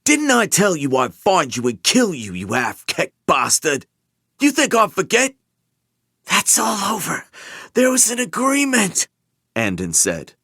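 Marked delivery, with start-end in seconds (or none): 0.0-5.3 menacing demand. Acted. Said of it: "Sunter demanded". 6.2-9.1 mumbling through pain. Acted. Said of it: "mumbling through the pain".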